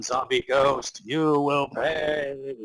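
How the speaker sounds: slow, menacing undead voice; a lightly voiced zombie Gordon; zombie ghost threat. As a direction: threaten him slowly from beyond death. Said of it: "Gordon Freeman standed up and said".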